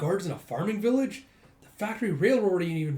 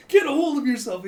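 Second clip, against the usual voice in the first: much higher, loud shout to snap her out; loud rebuke; 11 semitones higher, 10 dB louder than usual. Acted. Figure shaking dazed Kana awake, shouting. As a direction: yell to wake her up.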